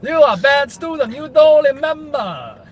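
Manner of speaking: loud, high mock Malaysian accent scolding; Malaysian accent impression. Scolding the friend as a bad student, loud high mock Malaysian accent. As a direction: ham up a Malaysian accent like a strict teacher. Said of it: "That, that was a good impression".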